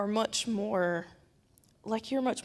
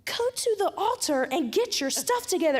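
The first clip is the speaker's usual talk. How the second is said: much higher, sharp mock telling-off; retold scolding; 10 semitones higher, 3 dB louder than usual. Scolding Justin in church, much higher telling-off. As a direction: order him to the altar, fired up. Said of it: "And I was like".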